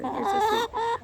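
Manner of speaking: high cooing voice for the hen. Real, not acted. Cooing to her pet chicken.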